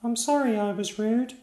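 put-on a boy apologising, embarrassed; piping kid knight sorry; sheepish little knight. A narrator's reading. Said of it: "then blushed down to his boots and said".

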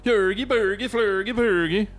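high singsong mock-Swedish gibberish; Swedish Chef. Co-host breaking into the greatest Swedish thing, Swedish Chef voice. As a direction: lilt it up high like a cartoon Swedish cook. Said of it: "It must be the Swedish Chef".

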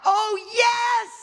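high, ringing preacher's cry; impassioned exclamation. Real, not acted. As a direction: cry it out to the congregation. Preacher cries out in mid-sermon.

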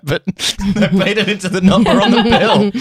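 high, laughing and incredulous; exasperated laughing protest. Retold or imitated. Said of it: "And I'm like".

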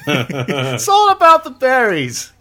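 slow, laughing mock TV pitch; It's Always Sunny quote. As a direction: ham up the berries line, giggling through it. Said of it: "all I can think of is It's Always Sunny".

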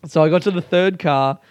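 usual speaking voice

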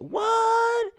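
high, loud shocked cry; a sudden high shocked what. Retold or imitated. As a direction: yelp it in disbelief. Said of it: "I said".

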